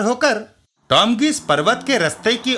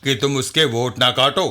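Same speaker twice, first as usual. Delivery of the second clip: lower, insinuating deal-making voice; shady party agent; 7 semitones lower than usual. Acted. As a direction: lean in with a veiled threat, lower. Party man pressures Raman.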